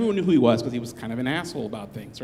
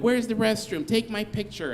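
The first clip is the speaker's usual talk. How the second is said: mock tourist; 7 semitones higher than usual. Mocking tourists in the park.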